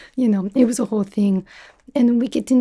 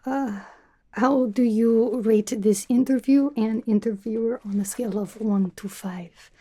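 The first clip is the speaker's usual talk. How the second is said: normal talking voice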